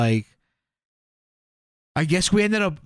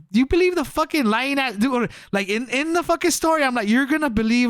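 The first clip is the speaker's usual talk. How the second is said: incredulous challenge; 8 semitones higher than usual. Retold or imitated. Scoffing at friends trusting the news.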